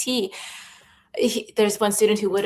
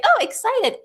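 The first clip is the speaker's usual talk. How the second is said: high, loud gushing oh; mock excited; 4 dB louder than usual. Shown as a demonstration. Teacher mimicking her own mood swings, excited. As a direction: swing up into over-the-top excitement. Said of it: "roller coaster".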